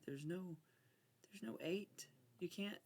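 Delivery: whispered. Real, not acted. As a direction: breathe "there's no eight" under your breath. Whispering at the cards.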